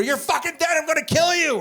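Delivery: high-pitched yell through a door; enraged threat. Retold or imitated. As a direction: imitate a furious brother making threats. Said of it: "He starts banging on my door".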